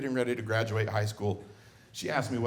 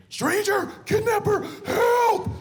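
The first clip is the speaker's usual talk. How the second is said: put-on shrill, much higher screaming child; 7 dB louder, slower than usual. Son screaming at dad to alarm onlookers, full shriek. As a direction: shriek it for the whole zoo to hear. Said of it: "he started screaming".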